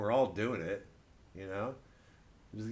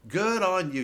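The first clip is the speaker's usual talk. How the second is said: lauter Spott; 8 semitones higher, 8 dB louder than usual. Acted. Repeats it louder, mocking.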